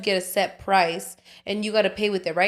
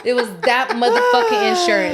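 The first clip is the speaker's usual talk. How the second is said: high, loud laughing jab; real laughing rant at the insurer; 9 semitones higher, 6 dB louder than usual. Real, not acted. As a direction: laugh it out while cursing the insurer.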